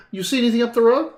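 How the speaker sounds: loud, high Scottish rider; Scottish accent. Acted. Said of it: "This is a decent Scottish".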